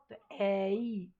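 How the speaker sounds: "एई" drawn out very slowly, tongue gliding; twisted vowel. Shown as a demonstration. Teacher slowly models the ai glide for students. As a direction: slide the tongue through "एई" slowly. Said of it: "थोड़ा सा twist करें".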